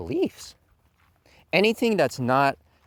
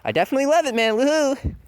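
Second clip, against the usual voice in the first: higher, fast, gushing fake excitement; mocking hype fan; 10 semitones higher, 4 dB louder than usual. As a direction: rattle off empty fan cheers. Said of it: "they're like".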